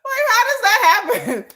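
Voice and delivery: ordinary talk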